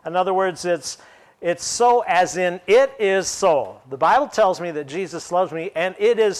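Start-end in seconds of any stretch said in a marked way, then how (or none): 1.4-3.8 firm, slow, falling "it is so." with weight on "it"; emphatic so. Shown as a demonstration. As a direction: say "it is so." as a settled certainty. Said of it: "There is that emphatic, affirmative, most certain".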